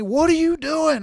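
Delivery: high, loud, slow hollering; retold hollering. Passengers hollering at the driver who swerved, high loud yell. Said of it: "they're hollering at me".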